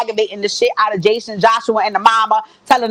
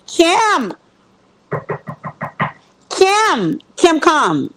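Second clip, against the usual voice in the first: calling out; 7 semitones higher, much slower than usual. Pretend. Summoning Kim like a preacher.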